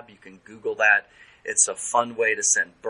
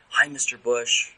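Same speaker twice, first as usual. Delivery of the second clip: a light, friendly phone greeting, voice somewhat raised; mock phone call to a patient; 4 semitones higher, 5 dB louder than usual. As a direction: play the therapist calling an old patient, brightly. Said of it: "I said to therapist".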